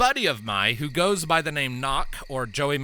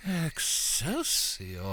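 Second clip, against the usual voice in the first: long drawn-out, woozy cry; dazed mushroom-tripper; 3 semitones higher, 6 dB quieter, much slower than usual. Acted. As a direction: stretch the word out as you drift off. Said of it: "one of them's just like".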